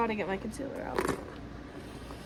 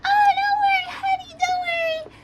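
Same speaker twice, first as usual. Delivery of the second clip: very high squeaky put-on voice; breezy mock reassurance way up high; 6 dB louder than usual.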